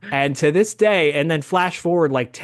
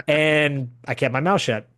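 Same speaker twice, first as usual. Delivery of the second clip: ordinary talk, nothing marked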